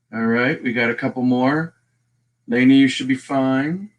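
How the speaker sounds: normal talking voice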